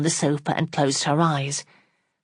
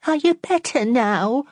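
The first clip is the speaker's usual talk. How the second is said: quavering; 9 semitones higher than usual. Acted. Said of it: "in a quavering voice".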